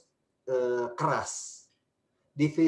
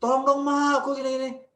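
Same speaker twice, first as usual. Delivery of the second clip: high, louder whiny begging; child whining at a weak mom; 12 semitones higher, 5 dB louder than usual. Shown as a demonstration. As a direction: beg mom with a whine. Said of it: "itu nggak tegas".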